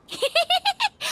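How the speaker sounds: bright delighted laugh, rising; high excited giggle. Acted. Paimon thrilled to join the detectives, high excited giggle. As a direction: giggle with glee.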